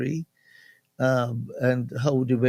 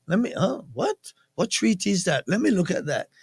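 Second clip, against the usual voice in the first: puzzled self-talk; 7 semitones higher, faster than usual. Retold or imitated. Acting out googling something doubtful.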